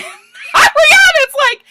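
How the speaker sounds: very high, loud, stretched-out squeal; excited shriek. Real, not acted. Vlogger retelling Ariana's clapback to Stassi, excited shriek. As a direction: shriek the set-up to a juicy comeback.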